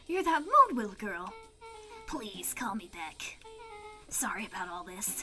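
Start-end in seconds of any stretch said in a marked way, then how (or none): 2.1-5.2 soft voice. Acted. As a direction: hush it sweetly to him. Player voicing Beck softly.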